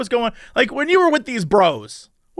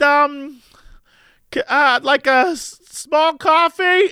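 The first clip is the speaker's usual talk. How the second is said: nervous drive-thru order; 8 semitones higher, slower than usual. Retold or imitated. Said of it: "I'm just like".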